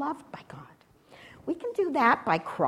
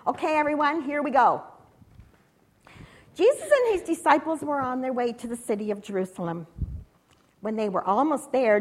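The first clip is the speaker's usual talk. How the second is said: usual speaking voice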